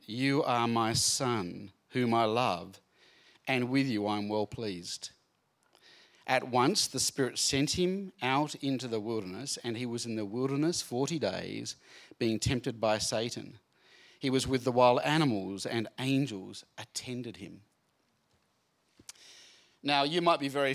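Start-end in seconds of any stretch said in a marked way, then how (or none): 0.1-1.7 slow, steady reading of the verse; measured Bible-reading tone; scripture reading. Acted. Read within the scripture passage. 1.9-17.6 solemn reading of scripture. Acted. Reads the Bible passage aloud.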